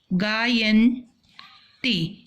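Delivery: गायन्ति stretched out very slowly; slow drawn-out model word. Shown as a demonstration. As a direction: pronounce गायन्ति slowly so pupils can copy. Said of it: "क्या हो गया".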